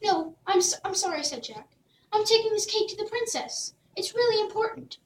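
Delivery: earnest little hero in a hurry; Jack's voice. Acted. Jack answers politely.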